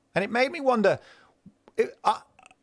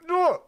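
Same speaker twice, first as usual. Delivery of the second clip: frustrated groan; 3 dB louder than usual. Real, not acted. Frustrated recalling setup trouble.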